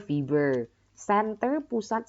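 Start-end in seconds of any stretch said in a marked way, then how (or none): none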